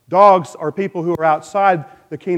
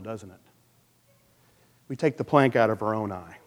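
ordinary talk, nothing marked

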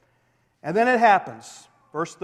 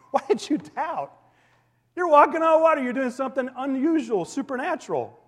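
higher, amused, lightly teasing; humorous Jesus; 6 semitones higher than usual. Retold or imitated. Jesus amused at Peter walking on water, playful. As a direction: chuckle through the rebuke, not condemning. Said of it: "kinda humorous to him".

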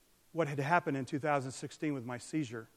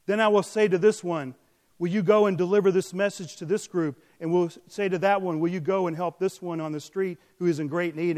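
louder, ringing prophetic reading; proclaiming prophecy; 2 semitones higher, 4 dB louder than usual. Acted. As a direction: declare the Lord's words to the congregation. Reading Jesus' words forcefully.

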